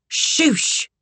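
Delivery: high, sharp hushing; snapped shush. A narrator's reading. Sugar silences Beulah's remark about Kit, snapping. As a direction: shut her up with a quick shush. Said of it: "Sugar snapped".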